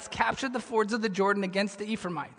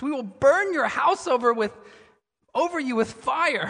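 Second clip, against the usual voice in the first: mildly fierce threat, higher and louder; acted angry warning, raised voice; mad threat; 5 semitones higher, 6 dB louder than usual. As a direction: threaten them with some heat. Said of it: "they're pretty mad".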